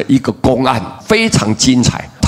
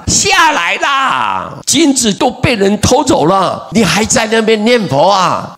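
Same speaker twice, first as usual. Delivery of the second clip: retold angry shout; 8 semitones higher than usual. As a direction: imitate a wife yelling for her husband to come down. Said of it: "他大声喊". (模仿太太大声喊叫)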